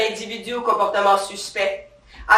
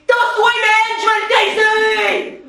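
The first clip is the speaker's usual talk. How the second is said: retold yell; 10 semitones higher than usual. Cop yells at her colleague to get out of her way, a high laughing yell. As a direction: bark at the colleague to move aside. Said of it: "J'ai hurlé".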